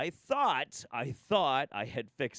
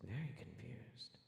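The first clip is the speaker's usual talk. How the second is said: whispered; 16 dB quieter, slower than usual. Real, not acted. Drops to near whisper.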